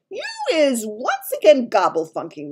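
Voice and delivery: loud, high giant reprimand; shouting giant. A narrator's reading. Giant fed up with Sophie's word muddling, shouting. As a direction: raise the voice sharply at her. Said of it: "the giant shouted".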